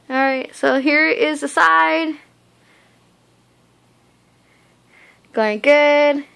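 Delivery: talking with mouth stretched open; lip pulled aside by finger. Real, not acted. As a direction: finger hooked in mouth corner. Seen 1: Finger hooks lip corner, pulling cheek back to show braces.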